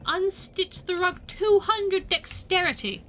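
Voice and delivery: loud, high and drawn out; stretched-out triumphant call; grand announcement. Pretend. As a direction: proclaim it slowly, hammed up. Hammed-up announcement of game option.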